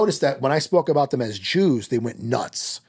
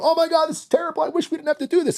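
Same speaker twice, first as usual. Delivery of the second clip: much higher, hammed-up fake lament; mock distress, pitched way up; mock sorrow; 13 semitones higher, faster than usual. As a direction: parody a pearl-clutching voice. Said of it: "They're not going".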